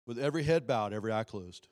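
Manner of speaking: loud, high, fervent preacher cry; pleading preacher altar call. Real, not acted. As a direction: preach the altar call at full force over music. Altar call at full force.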